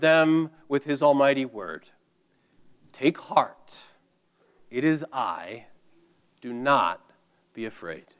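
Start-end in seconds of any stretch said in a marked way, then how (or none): 2.9-8.1 soft, fading reassurance as Jesus; calm Jesus, softer and softer; gentle comforting words. Acted. Jesus comforting his frightened disciples, soft and reassuring.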